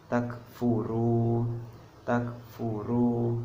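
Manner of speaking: Takfuru recited slowly, the u stretched two counts; two-beat long vowel. Shown as a demonstration. Teacher demonstrates mad tobi'i, slow recitation. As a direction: draw out the u of Takfuru for two beats. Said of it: "Contohnya seperti ini".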